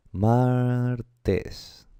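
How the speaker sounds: "Martes" drawn out as maaar-tes; syllable by syllable. Shown as a demonstration. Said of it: "Son dos sílabas". ("Martes" separado en sílabas: maaar-tes)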